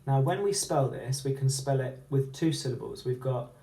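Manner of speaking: ordinary talk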